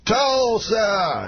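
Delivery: high, sustained stage call; Bill Cosby. Retold or imitated. Star calling the town's name to the audience, high impression. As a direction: ring it out high to a crowd, imitating the star. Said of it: "walks out, and he goes".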